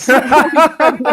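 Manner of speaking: amused self-correction; laughing correction. Real, not acted. Laughing at name slip.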